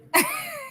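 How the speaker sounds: a short high real laugh; real light laugh. Real, not acted. Host laughs.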